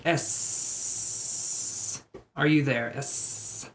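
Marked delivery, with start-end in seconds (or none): 0.0-2.0 whispered hissing S; drawn-out s like a snake. Pretend. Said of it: "sounds like I'm hissing like a snake". 2.9-3.7 soft unvoiced snake hiss; drawn-out s like a snake. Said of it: "Speaking in parcel tongue".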